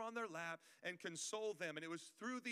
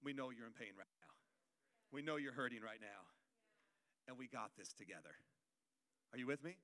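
tender comfort; 7 semitones lower, 4 dB quieter than usual. Acted. Church consoling hurting people, soft and tender. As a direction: hold them close with your voice. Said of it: "wrap their arms around them and said".

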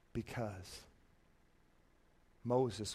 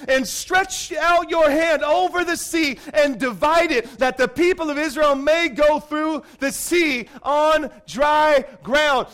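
loud, higher, slow commanding shout; booming voice of God; 4 semitones higher, 3 dB louder than usual. Retold or imitated. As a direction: command Moses from heaven, slowly. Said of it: "The Lord said to Moses".

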